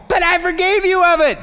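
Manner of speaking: a lightly voiced Jesus reassuring. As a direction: pitch up and plead as Jesus. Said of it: "Jesus is going".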